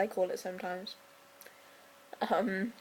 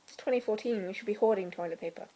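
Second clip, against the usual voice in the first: normal talking voice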